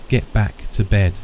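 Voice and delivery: plain reading voice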